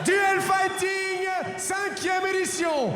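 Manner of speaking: high, slow, drawn-out shouted fighter intro; ring announcer. Shown as a demonstration. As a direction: call the fighter into the cage, stretching every name. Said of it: "On a envie de voir ce que ça donne en vrai".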